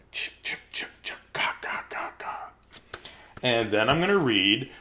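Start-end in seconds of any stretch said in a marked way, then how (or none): 0.1-2.5 breathy unvoiced ch ch ka ka, spooky; creepy whispered ch-ka sound. Teasing the kids before reading Creepy Carrots, whispered spooky sound. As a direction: hiss it like a lurking creepy carrot.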